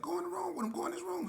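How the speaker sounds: urgent. Retold or imitated. His own urging retold.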